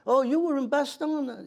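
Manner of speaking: high, slow surprised question; light female voice, amazed; surprised woman. Retold or imitated. Woman surprised meeting veteran.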